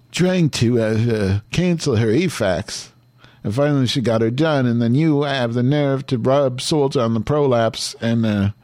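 high, loud Madge voice; Madge's outraged rant. Acted. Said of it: "This is Madge now".

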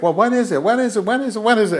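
high, rapid-fire repeated demands; pestering kid. Retold or imitated. Nephew hounding the aunt for an answer, mock pestering. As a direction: imitate a child nagging nonstop. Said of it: "And he never stops".

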